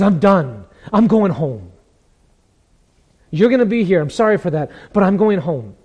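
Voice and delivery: loud, elated outburst; Paul's excitement. Acted. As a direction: announce your homecoming with rising joy. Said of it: "Can you hear the excitement in Paul's voice?".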